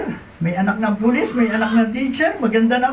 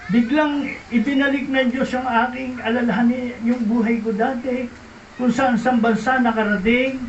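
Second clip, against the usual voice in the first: ordinary talk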